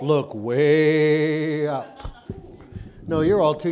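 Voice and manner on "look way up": put-on slow kids' show host. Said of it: "Look up".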